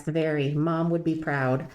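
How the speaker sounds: ordinary delivery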